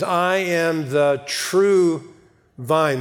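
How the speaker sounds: slow "I am the true vine" with "true" drawn out and weighted; stress on true. Shown as a demonstration. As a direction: lean hard on "true" and let "vine" settle down. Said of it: "the emphatic statement there is the word true".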